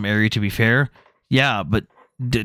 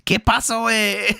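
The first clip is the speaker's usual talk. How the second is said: put-on higher, joking Mexican accent; 8 semitones higher, slower than usual. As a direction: toss it off in a mock Mexican accent. Mocking a Mexican guy.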